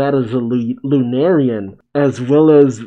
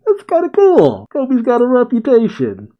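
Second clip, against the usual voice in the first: much higher, eager fan voice; delighted; 11 semitones higher than usual. Real, not acted. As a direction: gush about Kobe's fame.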